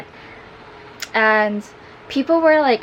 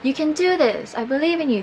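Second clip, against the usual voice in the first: mock call of encouragement in a raised pitch; imitated encouraging yell; 8 semitones higher than usual. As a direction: coach someone on in a high, light voice. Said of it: "yelling up at me".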